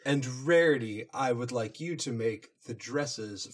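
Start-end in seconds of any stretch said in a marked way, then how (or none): none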